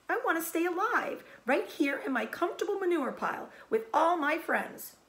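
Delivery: high, pleading wail. Acted. Wilbur wailing about life, high. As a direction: cry out your longing.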